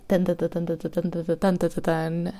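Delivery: hummed drumroll building to a rising tan; playful vocal drumroll; mock drumroll. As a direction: sing out a little fanfare before the news. Drumroll before announcing big news.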